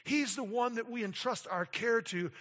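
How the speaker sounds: rising passionate sermon climax; full preaching. Acted. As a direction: drive it home from the pulpit.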